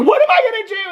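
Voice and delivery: high-pitched mock panic; mock distress. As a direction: imitate a wife wailing helplessly. Imitating a distressed evacuated wife.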